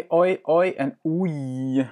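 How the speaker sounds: oi and oei drawn out slowly, the two sounds kept clearly apart; teacher contrasts oi and oei for the learner; slow Dutch diphthongs. Shown as a demonstration. Slow diphthong model. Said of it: "teacher models Dutch diphthongs".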